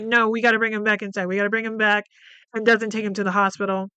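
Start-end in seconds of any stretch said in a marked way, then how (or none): 0.0-2.0 fast, pressing mother; quick, insistent mother; hurried insistence. Retold or imitated. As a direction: rush the words, insisting. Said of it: "And Laura's like".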